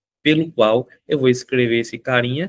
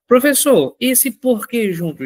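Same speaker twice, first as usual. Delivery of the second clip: student voice; 10 semitones higher, 4 dB louder than usual. Acted. Teacher voices an eager student.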